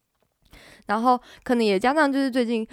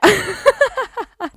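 high laugh; 11 semitones higher, 6 dB louder than usual. Real, not acted. Laughing at the retold joke.

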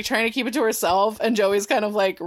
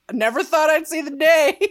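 louder and higher, breaking into laughter; laughing Grams quote; 5 semitones higher, 4 dB louder than usual. Retold or imitated. Grams hears Jen promise to devote her life to God, laughing. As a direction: quote Grams while cracking up. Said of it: "And Grams is like".

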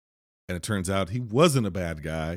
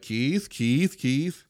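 higher, questioning calls of a name; retold calling out; 5 semitones higher, much slower than usual. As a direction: imitate calling after someone out of sight. Retold woman calling for missing man.

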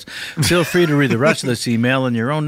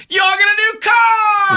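high, loud, drawn-out talk-show shout; Oprah; 4 dB louder, slower than usual. Acted. Impressionist performs on a stranger's request, huge high talk-show shout. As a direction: holler it like announcing a huge giveaway. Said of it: "Do Oprah".

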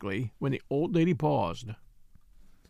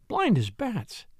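mild exclamation, pitched higher; exasperated cry; 4 semitones higher, slower than usual. A narrator's reading. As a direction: scold the men in mild exasperation. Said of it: "cried his wife".

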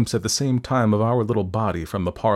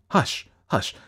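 raised, anxious shushing; urgent exclamation; 6 semitones higher than usual. A narrator's reading. Doctor silencing Fettes, urgent hushing. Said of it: "exclaimed the doctor".

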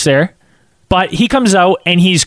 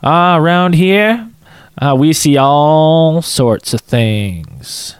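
ominous stranger; much slower than usual. Retold or imitated. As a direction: drag it out like a creepy local hinting at secrets. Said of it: "And the guy goes".

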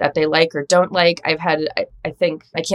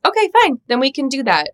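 mock giving in; 10 semitones higher, faster than usual. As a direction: cave in with a hammed-up sigh of a yes. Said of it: "And like".

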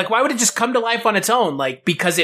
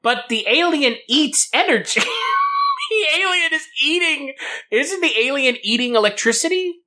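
laughing; 9 semitones higher than usual. Real, not acted. Excited laughing realization.

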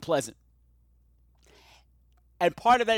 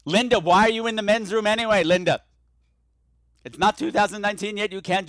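louder, higher incredulous scold; scolding a character; 5 semitones higher, 5 dB louder than usual. Acted. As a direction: call out the character for going in.

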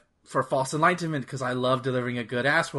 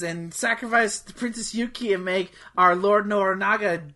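mock villain cutscene; 3 semitones higher than usual. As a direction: recite a villain's speech in mockery. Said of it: "watch the same".

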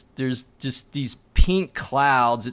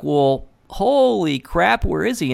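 surprised; 5 semitones higher, 3 dB louder, slower than usual. Retold or imitated. Looks back, Josh is gone.

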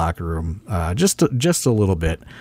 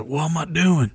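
put-on lightly raised old wrestler voice asking; a hint of the old-timer, pitched up; Tommy Rich impression; 8 semitones higher than usual. Tommy Rich asking the promoter his role, lightly imitated. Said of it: "my Tommy Rich impression was pretty good".

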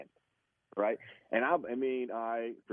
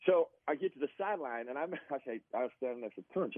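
ordinary talk

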